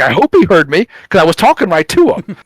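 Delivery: half-acted angry shout; mock furious retort. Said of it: "just furious about it".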